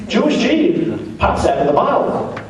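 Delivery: usual speaking voice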